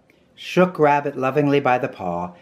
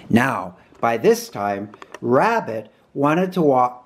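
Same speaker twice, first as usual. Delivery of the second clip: ordinary delivery, nothing marked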